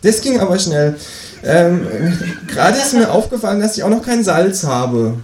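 high kangaroo voice, hesitant request; kangaroo character. Acted. Kangaroo comes back to borrow salt, high-voiced. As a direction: keep the kangaroo high while asking. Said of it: "sagt das Känguru überrascht".

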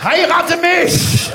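high, loud, drawn-out shout; shouted proposal. Pretend. Drill instructor to serving staff sergeant, mock-ecstatic shout. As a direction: bellow it slowly in mock rapture.

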